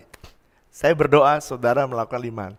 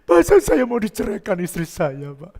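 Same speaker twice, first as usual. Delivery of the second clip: higher-pitched acted complaint; troubled neighbour, imitated; 6 semitones higher, 4 dB louder than usual. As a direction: lightly act a husband's plea for help. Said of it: "Saya ini lagi susah".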